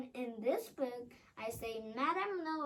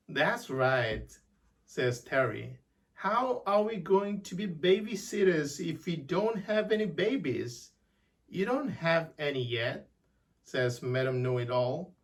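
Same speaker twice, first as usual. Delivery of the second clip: deep voiced dialogue, much lower; low put-on voices; 10 semitones lower, 7 dB louder than usual. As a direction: drop the voice low for every character. Whole dialogue in far voice.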